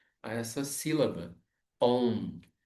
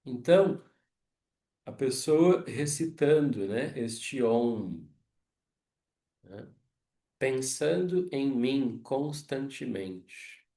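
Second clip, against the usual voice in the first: slow, raised voice quoting the verse; recited scripture; 3 semitones higher, 7 dB louder, slower than usual. Acted. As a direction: deliver the god's words with weight. Quotes Krishna's verse. (citando o verso com voz elevada e lenta)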